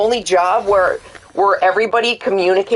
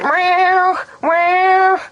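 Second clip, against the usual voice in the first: much higher imitated meows of a cat; copying the cat's meows in a far higher voice; cat meowing; 12 semitones higher, much slower than usual. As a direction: mimic a whiny cat meowing. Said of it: "all I'm gonna hear is".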